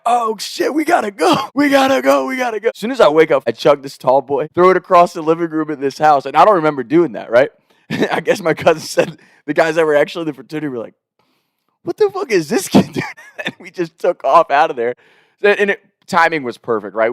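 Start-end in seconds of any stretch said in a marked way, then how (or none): 0.0-2.7 high, frantic yelling; panicked shout. Retold or imitated. Panicked waking up late. 11.8-13.1 very high, shocked exclamation; mock disbelief, way up high; incredulous outburst. Frat guys reacting incredulously.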